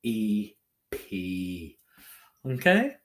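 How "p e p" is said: P-E-P as slow separate sounds, then joined into PEE; sounding out. Shown as a demonstration. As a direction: draw out P-E-P sound by sound. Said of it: "What do we hear in it?".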